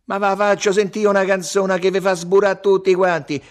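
high, loud bragging; loud boast. A narrator's reading. Salvatore promises the crowd a better song, boasting loudly. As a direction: shout over the rivals. Character shouts over rivals.

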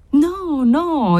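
high-pitched, drawn-out protest; exclaiming. A narrator's reading. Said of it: "esclamò Cesarino".

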